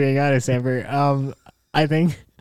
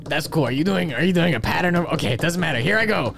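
ordinary talk

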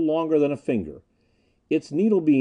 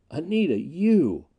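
amazed; 5 semitones higher, slower than usual. A narrator's reading. Lover amazed at her identity.

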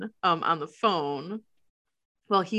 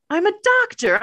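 much higher, louder huffy protest; mock indignant; 11 semitones higher, 5 dB louder, faster than usual. Doctor indignantly insisting he won't kill. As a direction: act offended. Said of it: "he says".

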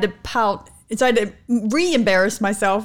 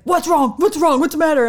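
higher, urgent imitation of a concerned dad; worried dad; 6 semitones higher, 4 dB louder than usual. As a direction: fire off anxious questions as Dad. Voices worried dad on the phone.

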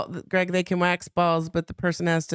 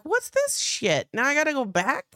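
high, slow, outraged squeak; exasperated disbelief; 7 semitones higher, slower than usual. Retold or imitated. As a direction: stretch it out in shocked disbelief. Retold own annoyed reaction.

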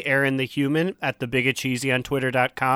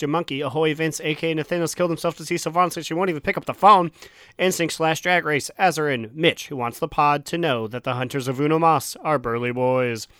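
normal talking voice

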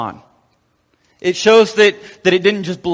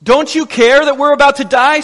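desperate plea; 4 semitones higher, 5 dB louder than usual. Retold or imitated. Desperate disciples rebuking sleeping Jesus.

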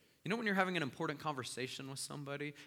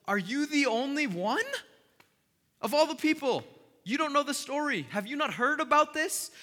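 very loud, high-pitched disbelief; incredulous sarcasm; 13 dB louder than usual. Acted. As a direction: shout it in exasperated amazement. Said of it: "He makes eye contact with Jesus and says".

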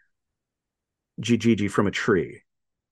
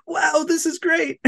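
much higher, hammed-up delight while falling; imitating joy in a fall, high; mock glee. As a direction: squeal it like a thrill ride. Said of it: "falling is".

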